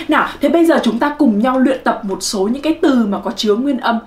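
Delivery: ordinary talk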